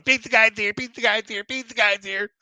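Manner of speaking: put-on high, loud little girl chant; excited toddler. Wet twin running to the door, high excited chant. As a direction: squeal it like a dripping-wet toddler.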